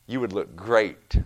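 slow, smooth salesman pitch; oily sales flattery, drawn out; car salesman. Retold or imitated. Preacher mimics a car salesman flattering a young buyer, smooth and slow. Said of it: "here's this car salesman going".

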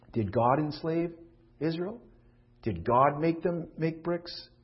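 ordinary delivery

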